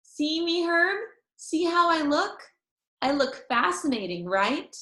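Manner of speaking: put-on high, eager little girl voice; girl showing her friend her missing tooth; Junie B showing off. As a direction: show off your gap to your friend. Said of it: "I smiled for him again".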